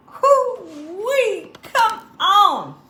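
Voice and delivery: high, loud whooping cry of delight. Real, not acted. Host tasting her sandwich on camera, whooping with delight. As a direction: holler like the taste knocked you over.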